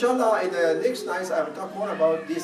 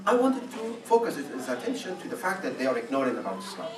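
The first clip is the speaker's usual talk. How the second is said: ordinary delivery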